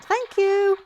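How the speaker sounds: a comic squeak of gratitude; mock high-pitched thanks; squeaky thanks. Squeaky thank you after scene.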